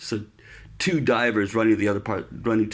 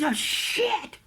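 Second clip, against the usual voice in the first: higher, alarmed mock curse; chief's oh shit. Chief reacting to the dislocated shoulder, alarmed. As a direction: react like the chief seeing the arm. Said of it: "he goes".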